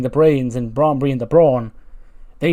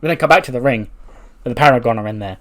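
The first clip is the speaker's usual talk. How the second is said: ordinary talk, nothing marked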